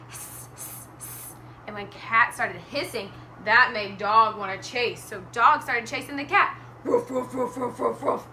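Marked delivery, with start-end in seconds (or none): 0.1-1.3 three soft voiceless hisses; cat hissing. Acted. Storyteller shows Cat hissing about, soft hisses. 6.8-8.3 quick run of woofs; dog barking. Acted. Storyteller shows Dog chasing the cat, barking.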